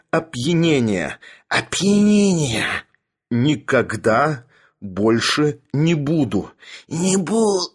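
slow, strangled, halting confession; hoarse, choking. A narrator's reading. Sharikov repeats his apology while choked, hoarse and laboured. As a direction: force each phrase out through a squeezed throat. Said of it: "охрипший Шариков".

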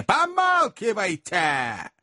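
high, very drawn-out command; slow, high, indignant order; furious retort. A narrator's reading. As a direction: stretch the word into a final warning. Magnus repeats retort, pacing.

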